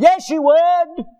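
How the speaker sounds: high, loud, drawn-out insistence; emphatic rebuttal. Real, not acted. Preacher contradicts listeners who want a say in heaven, loudly. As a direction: shout down the objection, stretching it out. Preacher overrules imagined objection.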